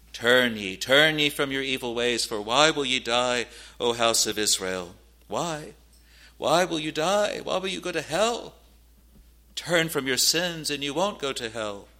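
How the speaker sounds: pleading pulpit appeal, pitch climbing on the questions; forceful, rising exhortation; impassioned preaching. Real, not acted. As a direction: plead urgently with sinners.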